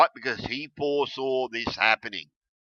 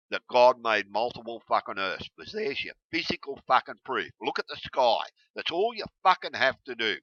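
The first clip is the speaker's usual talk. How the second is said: usual speaking voice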